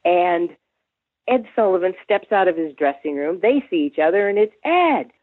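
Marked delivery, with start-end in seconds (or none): 4.7-5.1 high mock-gushing name calls; effusive greeting. Imitating two stars spotting each other backstage, effusive greeting. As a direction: trade names in swooping, high-pitched delight.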